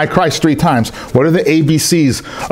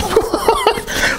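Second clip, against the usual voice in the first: very high jeering laugh; shrill mock laugh; mocking cackle; 4 dB quieter than usual. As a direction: cackle at the failure.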